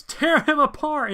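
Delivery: high, loud, drawn-out command; acted rallying shout; battle cry. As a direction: yell the order to attack.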